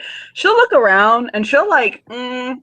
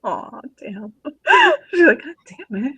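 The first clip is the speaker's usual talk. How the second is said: laughing despair; 5 semitones higher than usual. Real, not acted. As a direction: laugh till it cracks. Laughing rejection.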